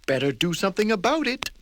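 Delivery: eager to get to work; fussy hurry; brisk fast prim voice. Acted. Mister Neat decides.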